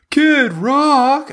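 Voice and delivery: loud high cartoon yell; Homer yell. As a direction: shout it like Homer. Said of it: "Homer just goes".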